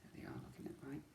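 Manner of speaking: fast unvoiced whisper. A narrator's reading. Narrator aside, fast whisper.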